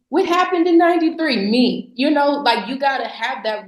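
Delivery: ordinary delivery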